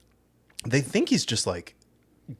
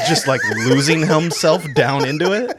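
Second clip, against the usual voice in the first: laughing; 6 semitones higher, 10 dB louder than usual. Real, not acted. Laughing through a joke.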